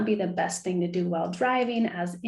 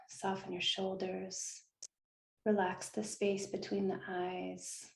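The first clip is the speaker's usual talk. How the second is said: guided meditation; 8 dB quieter than usual. Acted. Host guiding listeners through visualization, soft meditation voice. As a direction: gently lull listeners into stillness, hushed and unhurried.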